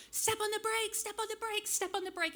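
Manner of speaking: mock panicked yell; frantic calling out. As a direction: call out to a sister in a runaway car. Running after the runaway car.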